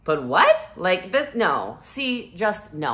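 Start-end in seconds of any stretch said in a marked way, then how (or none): none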